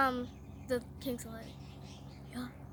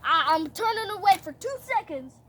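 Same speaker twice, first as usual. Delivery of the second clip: indignant rant; 5 dB louder than usual. Acted. King catching Haman begging his queen, indignant. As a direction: build into exasperated telling-off.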